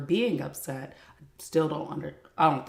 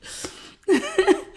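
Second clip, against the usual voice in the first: a high burst of laughter, much higher; 5 dB louder than usual. Real, not acted. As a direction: crack up laughing. Laughing after the quote.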